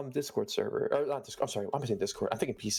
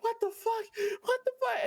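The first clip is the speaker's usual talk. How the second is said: high-pitched yelling in disbelief, much higher; retold yelling; slower than usual. Retelling a gross stream call to a friend, high disbelieving yell. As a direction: shriek it out in shock, half laughing. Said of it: "We're both yelling".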